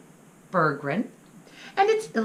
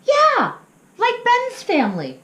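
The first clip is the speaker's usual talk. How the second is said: high, eager burst; excited little pup; 9 dB louder than usual. Acted. Little pup excitedly telling his parents about Ben. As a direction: jump in with a bright yes.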